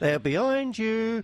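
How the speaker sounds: slow panto line. Retold or imitated. Mock panto line about Benitez.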